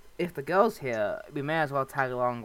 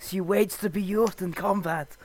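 creepy Russian priest; 5 semitones higher, 5 dB louder than usual. Acted. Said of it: "very creepy Russian priest".

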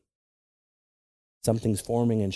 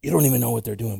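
normal talking voice

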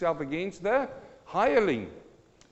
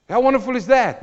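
high, loud, joyful cry; exultant exclamation; 10 semitones higher, 11 dB louder than usual. Acted. Preacher exclaiming to the congregation, high and loud. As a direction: shout your wonder to the church.

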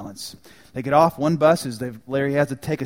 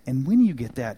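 normal talking voice

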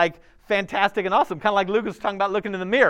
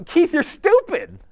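mock insult; 12 semitones higher, slower than usual. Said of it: "I'm go".

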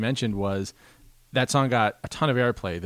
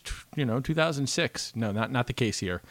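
normal talking voice

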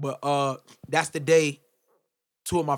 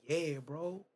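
subdued, softly retold reply; quietly, without any push; said just like that; 8 dB quieter than usual. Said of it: "he said it just like that".

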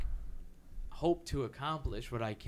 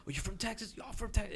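mock Texan greeting; 10 semitones higher, 4 dB quieter, faster than usual. Comic imitating people who learn he's from Texas, pitched-up friendly mock voice. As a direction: greet a fellow Texan like a frat brother, pitched up. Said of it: "where I'm like".

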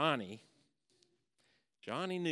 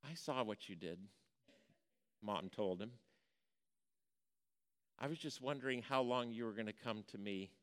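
soft, low, tender mother, much quieter; gentle mother; 6 semitones lower, 6 dB quieter than usual. Retold or imitated. Mother gently forgiving her tearful son, soft and tender. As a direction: ease the boy's guilt with a hush.

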